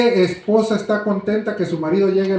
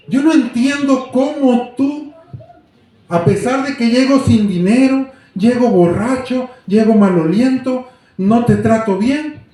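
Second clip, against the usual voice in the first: higher, slow, pleading outburst; a husband pleading with his wife to understand why she is kind; remorseful plea; 7 semitones higher, 5 dB louder than usual. Acted. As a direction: beg her to explain, voice straining upward.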